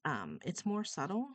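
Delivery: ordinary talk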